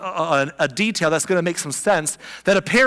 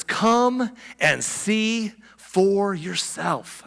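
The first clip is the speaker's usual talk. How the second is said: slow invitation; 3 semitones higher, much slower than usual. Retold or imitated. Philip's invitation, drawn out.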